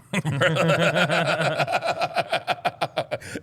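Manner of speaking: loud, high, drawn-out laughing fit; real helpless belly laugh; big loud laugh. Real, not acted. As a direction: burst into a long, high cackle. Reacting to the misspelling joke.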